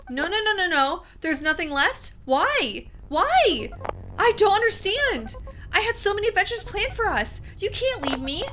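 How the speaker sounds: game character begging player to stay; anguished pleading. Acted. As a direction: beg them not to leave, breaking. Voicing the broken game character.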